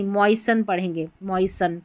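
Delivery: ordinary delivery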